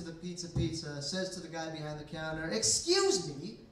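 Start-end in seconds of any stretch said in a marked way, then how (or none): 2.5-3.6 loud, high, stretched indignant demand; aggressive mother. Retold or imitated. Mother to the guy behind the counter, aggressive mock complaint. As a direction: imitate a fed-up customer, swooping up and dragging words. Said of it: "looking around kind of aggressively".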